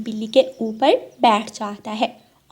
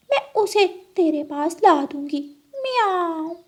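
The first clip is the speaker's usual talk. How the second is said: pleading cat; 7 semitones higher than usual. Acted. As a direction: plead like a scheming cat, meowing at both ends.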